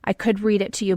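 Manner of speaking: ordinary delivery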